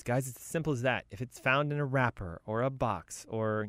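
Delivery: ordinary delivery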